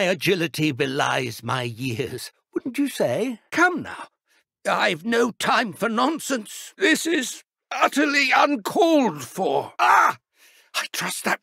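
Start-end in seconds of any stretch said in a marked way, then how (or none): 4.7-9.7 high, loud outraged protest; indignant shout. Acted. Professor struck by a student's spell, indignant shout. 9.8-10.2 sudden loud high yelp; startled cry. Acted. Professor hit mid-duel, startled cry. Struck by a spell.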